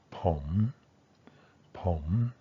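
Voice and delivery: low rising tone on "pom". Shown as a demonstration. Teacher shows learners Thai tones, "pom" with a low rise. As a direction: say "pom" starting low, then sweep upward. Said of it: "a rising tone".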